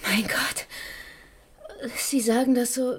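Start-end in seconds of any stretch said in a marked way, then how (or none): 0.1-0.7 breathy whispered dismay; shocked whisper. Acted. Officer reacting to the death, shocked whisper. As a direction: let it escape on the breath.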